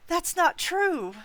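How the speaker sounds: high, slow, distressed denial; shaking voice. A narrator's reading. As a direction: draw it out, high and upset like a shaken ghost woman. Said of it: "her voice shaking".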